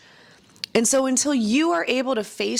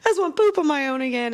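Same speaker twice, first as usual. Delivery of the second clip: higher, faster, pleading and fed up; exasperated outburst; 6 semitones higher, faster than usual. Retold or imitated. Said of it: "I'm like".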